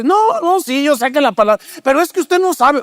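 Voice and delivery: high, insistent objection, cutting in; a pleading churchgoer interrupting the pastor; protesting counselee. Retold or imitated. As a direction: push back, sure nobody understands.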